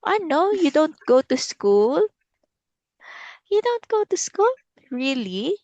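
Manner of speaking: surprised. Acted. Mock surprise at child's answer.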